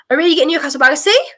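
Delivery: ordinary talk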